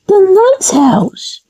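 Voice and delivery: ordinary talk, nothing marked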